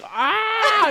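high yelp of laughter; laughing yelp. Retold or imitated. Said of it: "I'm just like".

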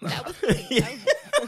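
high, loud words through laughter; admitting she cried at Lion King, laughing; laughing admission. Real, not acted. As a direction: confess it while laughing.

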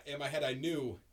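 normal talking voice

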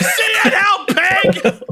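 high, yelled taunt; mock shout at the cop. Driver taunting the cop while driving away, a high yell. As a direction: fling it after the cop like a parting shot. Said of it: "you're like".

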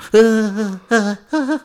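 squeaky laugh high up; a delighted high laugh; high giddy laugh. Real, not acted. Laughs after nerdgasm voice.

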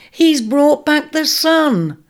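high, slow, thrilled exclamation. A narrator's reading. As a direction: announce the wonder. Youngest ram thrilled at sun.

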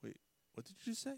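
hushed, high, quick question; acted quiet double take; mock stunned disbelief. Acting out stunned man's reaction.

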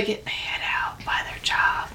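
soft whispered aside; whispering. Real, not acted.

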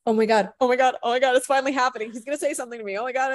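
breathless excited fan, rising pitch; starstruck gushing. Retold or imitated. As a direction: gush like a starstruck fan finally meeting a hero. Meeting Shia at last.

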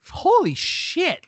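high, drawn-out holy shit; incredulous, slow and high; disbelief. Real, not acted. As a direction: stretch it in mock shock. Reacting in disbelief.

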